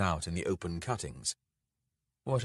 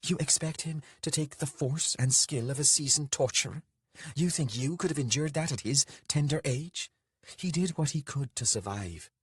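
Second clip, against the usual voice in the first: higher-pitched, indignant challenge; rounding on him in rising anger; sudden rage; 8 semitones higher than usual. A narrator's reading. Lilith defending the lad to Beatrax, in sudden rage. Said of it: "suddenly a rage coming upon her".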